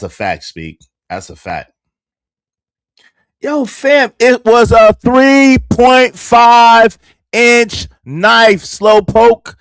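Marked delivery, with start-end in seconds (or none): 3.4-9.5 loud, slow, heated shout at a chatter. Real, not acted. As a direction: yell it out slowly, fed up.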